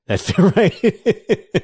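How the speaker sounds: laughing through the words; a real laugh breaking into it; amused, laughing while finishing the thought. Real, not acted. Laughs at own remark.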